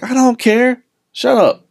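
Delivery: high-pitched mock irritation; mock dismissive. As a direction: brush him off in exaggerated annoyance. Acts brushing off small-talking man.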